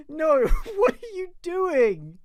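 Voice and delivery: high, slow laugh-cry of disbelief. Real, not acted. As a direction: laugh helplessly at the absurdity.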